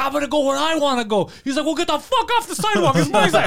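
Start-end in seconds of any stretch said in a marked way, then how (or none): 0.0-1.3 high defiant mock retort; imitated arguing. Customer arguing outside the shop, high mock defiance. 1.6-3.5 high mock yell, rising in volume; imitated arguing. Worker arguing over the sidewalk, high mock yell.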